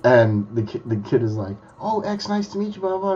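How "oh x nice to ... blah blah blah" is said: high-pitched mock fan greeting; imitated eager fan. Young fan meets his idol, imitated as eager. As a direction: rattle off a fan's greeting in a higher voice.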